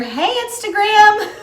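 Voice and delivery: bright, high hello to the viewers; upbeat shout-out greeting; cheerful call-out. Real, not acted. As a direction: call out to the audience with a lift.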